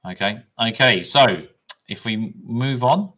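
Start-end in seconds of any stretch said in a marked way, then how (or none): none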